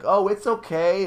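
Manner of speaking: calling out to reassure an unseen ghost; soothing the ghost. Retold or imitated. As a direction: sweet-talk the ghost nervously. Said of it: "tried to make her feel better by talking out loud".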